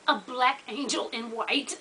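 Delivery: slow, softened imitation of a solemn claim; understated mock reverence; retold quote. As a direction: quote it slowly and quietly, lifting at the end. Quoting Phaedra's line before mocking it.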